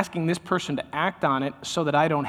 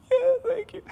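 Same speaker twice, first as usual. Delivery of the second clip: choked thanks. Acted. Overcome person thanks comforter.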